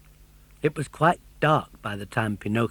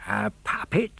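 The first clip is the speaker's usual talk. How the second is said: puzzled question; 9 semitones higher than usual. A narrator's reading. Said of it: "said the fisherman".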